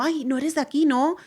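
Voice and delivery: high, lightly voiced surprised shopper; imitating surprised local. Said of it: "abro la boca en el supermercado".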